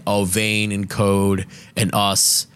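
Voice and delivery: normal talking voice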